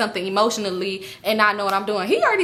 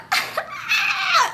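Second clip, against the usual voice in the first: very high-pitched squealing laugh; excited squeal. Retold or imitated. Woman reacting to her man's jet ski invitation, excited squeal. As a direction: let out a shrill, delighted squeal.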